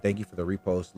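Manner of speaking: usual speaking voice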